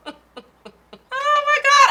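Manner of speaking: high, loud, slow cry through laughter; laughing exclamation. Real, not acted. Vlogger cracking herself up after her whispered joke, laughing. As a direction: squeal at your own joke.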